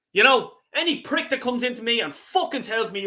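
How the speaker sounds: ordinary talk, nothing marked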